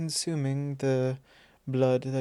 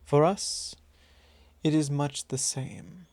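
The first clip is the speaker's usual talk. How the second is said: ordinary talk, nothing marked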